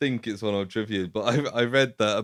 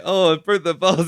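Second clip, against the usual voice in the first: higher, louder mock dismay; mock surprised dad; 7 semitones higher, 4 dB louder than usual. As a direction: imitate a flustered old dad breaking the bars. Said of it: "and he's like".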